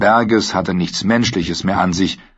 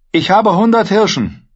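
high, loud blurted offer; urgent offer; 12 semitones higher, 5 dB louder than usual. Acted. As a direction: force the words out. Brienne bietet Lösegeld. (laut, gepresst)

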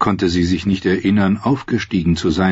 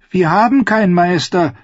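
put-on high, louder young girl; girl's voice; 11 semitones higher, 3 dB louder than usual. A narrator's reading. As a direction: answer plainly as a young girl. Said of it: "die Stimme eines Mädchens".

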